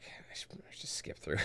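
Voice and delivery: hushed whisper to himself. Real, not acted. Streamer whispering to himself while greeting chat, hushed. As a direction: whisper it under your breath.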